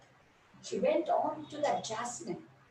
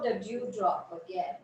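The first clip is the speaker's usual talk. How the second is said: ordinary delivery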